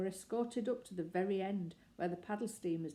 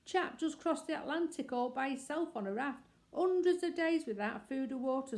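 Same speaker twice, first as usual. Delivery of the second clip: higher, calling back over the shoulder; called-out shout; 8 semitones higher, 4 dB louder than usual. A narrator's reading. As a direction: shout the news to strangers as you hurry past. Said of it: "and shouted".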